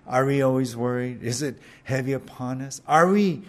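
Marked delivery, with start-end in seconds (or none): none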